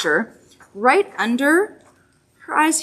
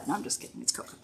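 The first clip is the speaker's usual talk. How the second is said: whispering; 14 dB quieter, faster than usual. Real, not acted. Playful joke aside.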